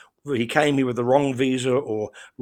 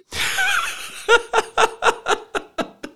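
long, high, loud laugh; laughter; 4 dB louder than usual. Real, not acted. Laughing after a dirty story about southern belles, high and loud. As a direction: burst out laughing, high and prolonged.

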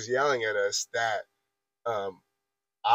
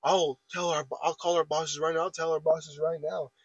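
retold yelling; 4 semitones higher than usual. Coworker threatening to call the bosses, mild mock yell. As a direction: imitate him agitatedly repeating a threat. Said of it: "that's when Daniel starts yelling".

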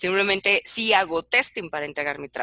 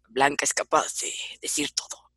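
whispering; 7 semitones lower, 4 dB quieter than usual. Retold or imitated. Coworkers gossip about her.

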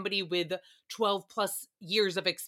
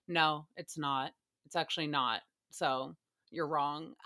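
mock blunt voters; 6 semitones lower, 6 dB quieter than usual. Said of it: "everybody's like".

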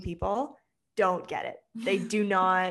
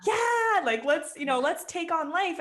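pumped up; 9 semitones higher than usual. Retold or imitated. Someone newly into manifesting, pumped about life, an excited high cheer. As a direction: cheer yourself on, all fired up. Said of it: "you get all, like, pumped".

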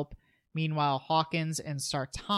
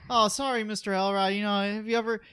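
lightly voiced kid, higher and a bit louder; imitated apologetic kid; 6 semitones higher, 4 dB louder than usual. Kid mistaking Michael for the groundskeeper, imitated apology.

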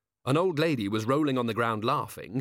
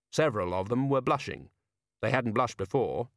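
plain reading voice

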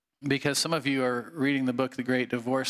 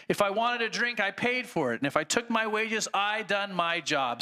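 boastful ghost; 4 semitones higher than usual. Acted. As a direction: brag it like an honest working man. Ghost boasting, slapping his chest.

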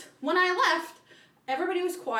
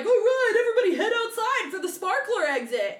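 DJ announcer voice; 7 semitones higher than usual. Retold or imitated. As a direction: call the crowd outside. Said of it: "he's like".

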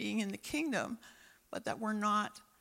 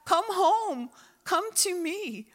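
higher and much louder, a ringing call, slowing on the pleas; voice of God calling; 8 semitones higher, 6 dB louder than usual. Acted. God calling Israel home through the prophets, booming. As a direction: cry it out like a prophet summoning a nation. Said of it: "God would send Israel the prophets and say".

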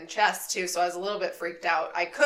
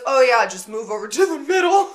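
louder and higher, breezy driver brushing it off; mock driver voice; 6 semitones higher, 9 dB louder than usual. Driver telling passenger to just move over, mock breezy. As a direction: play the careless driver, brightly dismissive. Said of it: "And he goes".

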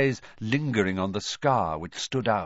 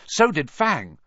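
plain reading voice

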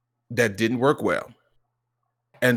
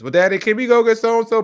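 put-on loud, high child voice; eager little son; 11 semitones higher, 8 dB louder than usual. Son asking Daddy for a treat, high and eager. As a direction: plead like an excited kid.

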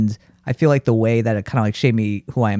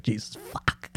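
breathless whispered swear; whispered curse; 13 dB quieter than usual. Retold or imitated. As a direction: hiss the curse under breath. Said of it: "you're like".

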